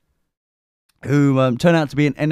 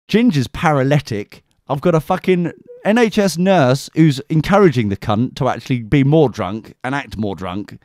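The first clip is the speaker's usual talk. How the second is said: ordinary delivery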